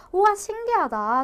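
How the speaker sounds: high, slow, wide-eyed wow; mock amazement. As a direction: play a student marvelling at it. Mock student amazed at grammar. (신기해하는 학생 흉내로 감탄하며)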